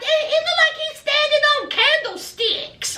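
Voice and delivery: high, disbelieving exclaim; high mock amazement; astonished. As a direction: marvel at the sight. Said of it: "And I'm like".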